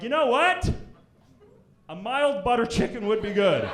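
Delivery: hammed-up eager order; mock sudden change of heart. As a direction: cave in with fake delight. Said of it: "I'm like".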